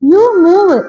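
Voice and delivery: high, loud "You move" called across the road; mock yell at the bus; calling out. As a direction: shout it at the other car. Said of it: "എന്ന് വിളിച്ച് പറഞ്ഞുകൊണ്ടിരിക്കുന്നുണ്ട്".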